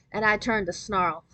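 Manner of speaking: plain reading voice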